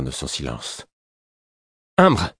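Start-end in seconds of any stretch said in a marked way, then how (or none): 2.0-2.3 high, loud one-word call; sharp summons. A narrator's reading. Angry prince forcing old adviser to speak, sharp. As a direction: summon him abruptly, voice jumping up.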